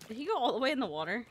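usual speaking voice

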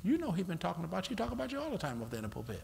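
soft, very fast insinuation; quick paranoid murmur. Retold or imitated. Devil whispering that the pastor preaches about you. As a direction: rush the suspicion under your breath.

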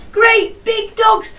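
high, slow and excited. Acted. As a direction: marvel at the big dogs. Teacher excited about dogs.

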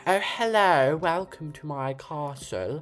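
high, hammed-up posh British royal accent with slow, grand vowels; mock upper-class British lord welcoming guests to his castle; posh king's accent. Girl mocking the king's posh British accent to her viewers. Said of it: "like, the king's accent".

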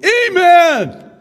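high, loud churchgoer shout; yelled amen. Retold or imitated. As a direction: shout it out from the pews. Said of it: "there's not a lot of people yelling".

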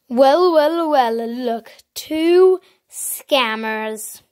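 very slow gloating villain drawl; sneering panto villain. Acted. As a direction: savour each word like a cartoon villain. Catches two scammers.

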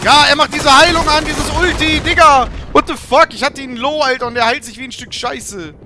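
loud, high frustrated rant; outraged shouting. Real, not acted. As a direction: yell in disbelief at the screen. Enemy heals during fight.

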